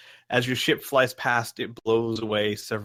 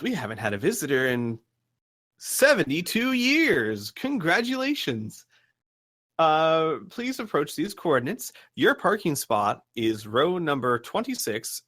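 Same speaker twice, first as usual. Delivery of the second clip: lilting station voice with high leaps; parking announcer, stretching and lifting key words; radio announcer; 4 semitones higher than usual. Acted. Game master as radio voice directing visitors to their spot, singsong announcer. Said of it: "the voice over the radio says".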